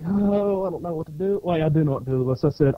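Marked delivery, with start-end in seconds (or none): 0.0-1.4 put-on dithering, higher at the start; mock helplessness. Man facing a poser pretends he's stumped, mock helpless. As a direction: pretend to be at a loss. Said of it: "I'm like".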